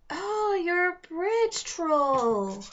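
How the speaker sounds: high, slow delighted discovery; light up as if meeting a fairy tale; girl thrilled to meet a real troll; excited realization. Acted. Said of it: "Alex said happily".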